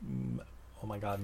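ordinary delivery

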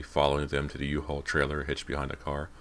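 ordinary delivery, nothing marked

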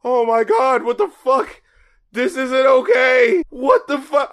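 rising appalled outburst; shocked oh my God; horrified disbelief. Retold or imitated. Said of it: "And I'm just like".

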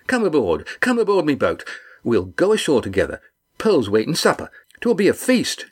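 a big man delighted to see friends; hearty fisherman. Acted. Red Ian greeting the crew, hearty and glad.

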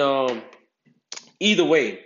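normal talking voice

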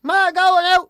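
high, loud holler to Ma; parrot mimicking kids. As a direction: shout it like a parrot copying the children. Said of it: "He memorised all the kids' voices".

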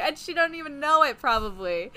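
high, loud and laughing. Real, not acted. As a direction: laugh through the words. Laughs through the joke.

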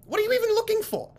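high, strained voice climbing to a near-squeal; exasperated shopkeeper. Acted. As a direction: berate the frog, rising in pitch. Shopkeeper angrily interrogates.

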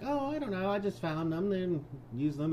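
high sweet innocent voice; mock innocent wife. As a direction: play the wife feigning innocence.

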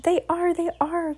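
high, eager agreement; high-pitched chorus of followers. A narrator's reading. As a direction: chime in high, like a chorus of witches. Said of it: "chorused the English witches".

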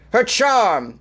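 "charm" drawn out high and falling, mock-seductive; hammed-up sultry read. Reading sultry beer ad copy.

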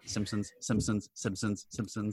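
soft, slow repeated murmur; muttered recognition. Retold or imitated. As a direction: tick each one off quietly to yourself. Acting out his thoughts while watching.

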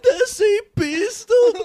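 high, drawn-out wail; mock crying. Suspect naming his gun to police, mock crying. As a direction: stretch every word in a comic sob. Acted suspect confessing weapon.

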